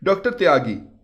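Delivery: ordinary delivery, nothing marked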